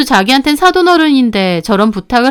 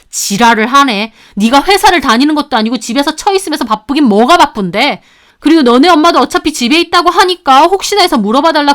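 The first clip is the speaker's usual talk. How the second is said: acted angry tirade; furious cursing; 2 semitones higher than usual. Sister-in-law curses out the woman who refused babysitting, furious. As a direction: tear into her indignantly. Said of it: "신우 완전 개거품 물고 저한테 따지더라고요".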